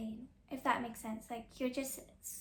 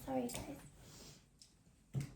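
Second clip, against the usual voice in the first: slow whispered sorry, much softer; slow whisper, softer than her chat; whispered aside; 9 dB quieter, much slower than usual. Real, not acted. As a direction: breathe the apology under your voice. Aside apology about her accent.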